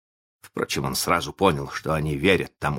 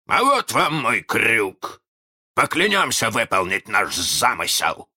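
loud, high pirate captain; rousing captain's call to swear, higher and louder; Captain Hook; 6 semitones higher, 4 dB louder than usual. A narrator's reading. Hook has his crew swear, high captain voice.

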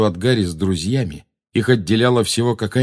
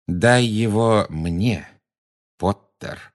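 very slow, deliberate demand; measured, spaced-out delivery; much slower than usual. A narrator's reading. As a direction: space the words out, pausing before the name. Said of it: "с расстановкой повторил голос". (с расстановкой, медленно)

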